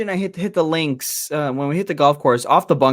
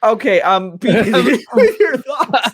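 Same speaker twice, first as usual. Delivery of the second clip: laughing; 6 semitones higher, slower than usual. Real, not acted. Host laughing through a tech mess.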